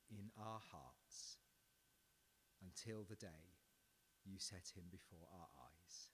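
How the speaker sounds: slow, soft closing prayer; hushed pastoral prayer. Real, not acted. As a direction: pray quietly and reverently.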